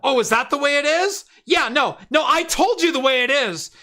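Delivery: high, loud, sharp phone rebuke; angry boss on phone. Retold or imitated. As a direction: tell off the insurer on the phone. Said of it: "the pouring rain going".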